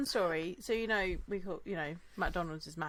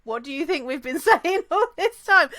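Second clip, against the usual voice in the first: rising to a much higher, louder laughing disbelief; exasperated retelling; 6 semitones higher, 12 dB louder than usual. Retold or imitated. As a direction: tell the friend the obvious, cracking up. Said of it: "I'm like".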